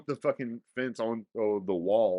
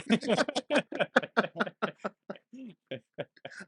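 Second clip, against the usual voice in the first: long, high hearty laugh; 10 semitones higher than usual. Real, not acted. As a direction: burst out laughing for real. Laughing at the story.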